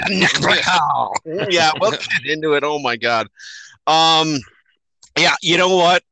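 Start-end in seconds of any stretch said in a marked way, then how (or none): 0.0-1.5 laughing. Retold or imitated. Laughing repeat of quote.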